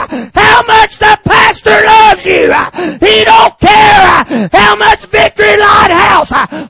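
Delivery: high, loud crying preacher's shout; preaching at full force. Acted. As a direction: pour it out at the top of your voice, near tears.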